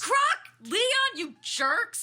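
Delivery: loud, high, slow furious shout; drawn-out outraged yell; angry yell. Acted. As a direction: yell it at the pranksters. Scolding pranksters after the scare.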